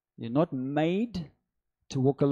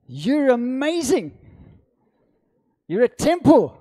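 loud, raised, drawn-out proclamation; full-force preaching; 6 semitones higher, 8 dB louder, slower than usual. Real, not acted. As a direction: proclaim it to the congregation in slow, ringing bursts.

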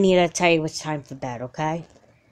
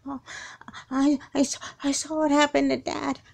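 shaken stammer; 8 semitones higher, 4 dB quieter than usual. Acted. Girl waking from nightmare.

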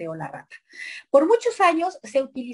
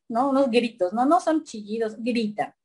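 normal talking voice

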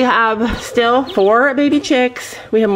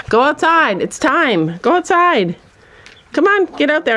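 high, raised calls to the animals; calling out; 6 semitones higher than usual. Real, not acted. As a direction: shoo the birds out firmly. Shooing animals outdoors.

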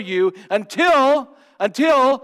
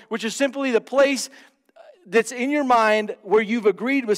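high, driving preacher's voice; fervent preaching; 6 semitones higher, 5 dB louder than usual. Acted. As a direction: preach it out to the congregation. Preacher at full force.

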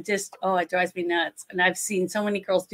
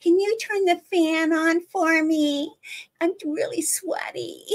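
mock a sultry bimbo; imitating a breathy bimbo, much higher; 7 semitones higher than usual. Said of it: "It's just so bad".

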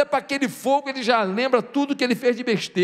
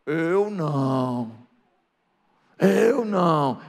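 long, stretched-out mock denial; drawn-out denial; 5 semitones lower, much slower than usual. Peter disowning Jesus when questioned, drawn-out denial. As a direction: drag the words out slowly in denial.

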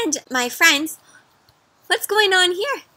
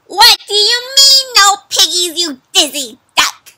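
much higher, loud witch screech; angry witch; 8 semitones higher, 7 dB louder than usual. Acted. Witch screeching at the dizzy duck, high and loud. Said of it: "She doesn't sound too happy".

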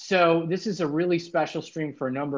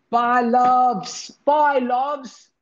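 high, louder mock British "Bye, loves"; British accent; 11 semitones higher, 6 dB louder, much slower than usual. Saying goodbye to Val in a hammed-up British accent. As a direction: put on a playful British accent, pitched up. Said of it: "with a British accent".

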